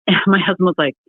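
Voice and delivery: ordinary delivery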